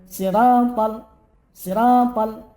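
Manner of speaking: "Siratal" with a thick, emphatic ta; ta pronounced heavy. Shown as a demonstration. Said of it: "Ta, ta, ta".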